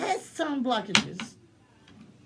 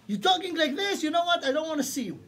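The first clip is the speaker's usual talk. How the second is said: louder, higher burst of exasperation; hammed-up telling-off, raised in pitch and volume; mock angry rejection; 5 semitones higher, 5 dB louder, faster than usual. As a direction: act out an angry parent cutting a child off. Acted angry rejection of the child.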